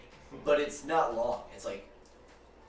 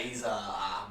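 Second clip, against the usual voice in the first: ordinary talk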